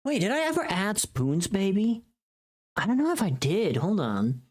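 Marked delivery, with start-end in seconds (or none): none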